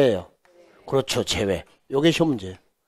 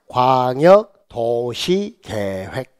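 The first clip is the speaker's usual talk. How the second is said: very slow, every syllable drawn out; slow classroom chant; repeat-after-me; 3 semitones lower, much slower than usual. Shown as a demonstration. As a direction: stretch the term so the class can follow. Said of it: "따라서요".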